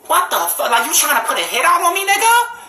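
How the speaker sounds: high voice climbing to a squeal; high-pitched stunned challenge; incredulous alarm. Retold or imitated. Retelling own alarmed reply to threat.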